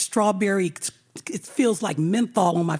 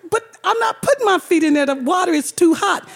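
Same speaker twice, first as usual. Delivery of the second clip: high, loud, fast refusal; mock panicked refusal; panicked protest; 8 semitones higher, 3 dB louder than usual. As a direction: push back in a rush. Refusing hot water again.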